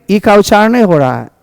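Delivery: ordinary delivery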